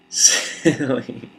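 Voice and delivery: high, loud laugh through the word; laughing silly. Shown as a demonstration. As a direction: burst out laughing while saying silly. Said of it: "say the word silly in many different ways".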